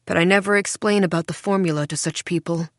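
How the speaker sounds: plain reading voice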